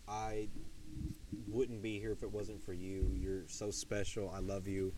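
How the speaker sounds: proposing to Chloe, speaking tenderly; tender vow. Retold or imitated. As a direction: murmur it close, like a private promise. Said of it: "I'm like".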